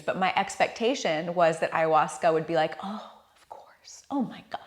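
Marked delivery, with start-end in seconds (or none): 2.8-4.6 breathless hushed whisper; apologetic whisper. Pretend. Imagined ayahuasca apologising to her, whispered.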